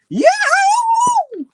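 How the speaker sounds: shrieking high cartoon cat yell, very loud; badly done cartoon cat howl; Tom cat scream. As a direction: belt it out like a screeching cartoon cat. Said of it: "That was a terrible impression".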